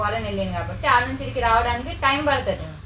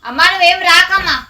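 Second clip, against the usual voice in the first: loud, higher call to mother; raised voice, warning someone off; 4 semitones higher, 8 dB louder than usual. Real, not acted. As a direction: shout across to stop someone coming. Calls to mother not to come.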